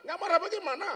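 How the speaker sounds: high, loud angry wife; angry retort. Acted. Wife angrily refusing to calm down. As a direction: fire back at him.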